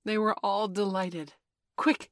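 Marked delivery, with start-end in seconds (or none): 1.8-2.1 loud commanding call; sharp order. A narrator's reading. Peter ordering the boys to work, commanding. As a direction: give the order with force. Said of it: "he ordered them".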